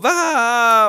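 loud shocked scream; dying gasp-scream. Acted. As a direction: scream and collapse. Old count dies of shock.

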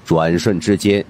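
plain reading voice

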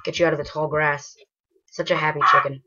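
ordinary talk, nothing marked

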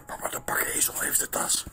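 breathy unvoiced whisper. Real, not acted. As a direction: keep it a hushed whisper. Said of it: "Waar moeten we fluisterend vloggen".

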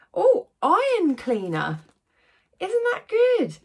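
loud, high, thrilled "oh" at the gift; delighted surprise. Real, not acted. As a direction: gush over a small present.

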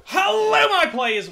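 high, loud hello breaking into laughter; big laughing comeback hello; hammed-up greeting. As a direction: belt out a showy welcome back. Greets players on returning.

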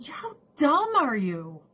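high, scornful put-down; scornful mock rant, pitched high; mock retort. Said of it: "I'm like".

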